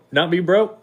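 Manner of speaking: high-pitched rise up to BRO. Acted. Alabamian showing he can say it, high rising tone. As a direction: push it up high, lifting on bro. Repeats phrase, higher.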